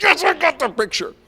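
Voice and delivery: irritated guide, mock voice; annoyed guide. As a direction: snap it back in mock exasperation. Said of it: "Tamir's like".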